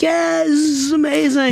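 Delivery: pretending to be thrilled for his wife, pitched high; put-on excitement. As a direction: fake joy to protect her. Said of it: "but I had to, like".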